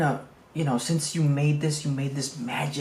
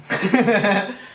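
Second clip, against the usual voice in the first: short chuckle; 5 semitones higher, 4 dB louder than usual. Real, not acted. Laughs at "too good" joke.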